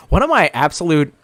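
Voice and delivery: usual speaking voice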